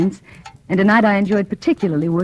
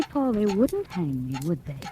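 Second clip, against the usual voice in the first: soft taunt; 8 dB quieter than usual. Acted. Wife taunting dying husband softly.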